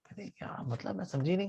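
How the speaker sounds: soft hushed reply; whisper. Acted. Girl whispering back to Jaimini from behind the door. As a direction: barely breathe the words.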